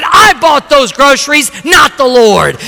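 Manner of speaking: high, loud triumphant boast; gloating neighbor. Retold or imitated. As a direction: rub it in as the atheist neighbor. Neighbor boasts he tricked her.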